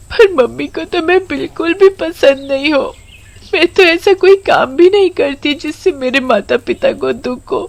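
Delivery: put-on high child voice, tearful and sobbing; a lonely girl crying under a tree; crying little girl. Komal alone under the tree, weeping about her mother's neglect. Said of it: "बहुत जोर जोर से रो रही".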